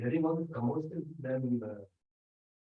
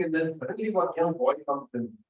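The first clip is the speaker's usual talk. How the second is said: ordinary talk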